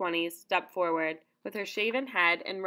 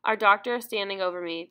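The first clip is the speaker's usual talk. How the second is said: ordinary delivery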